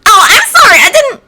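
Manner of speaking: high, bright-voiced girl apologising; a flustered girl saying sorry; girlish apology. Flustered classmate apologising in a high voice.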